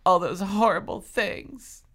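high, wounded lament. A narrator's reading. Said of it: "revealing more sadness than anger".

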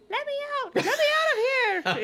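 very high, squeaky little cry; tiny dummy voice. A narrator's reading. Dummy crying from inside the closet, tiny high voice. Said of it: "a muffled voice coming from inside the closet".

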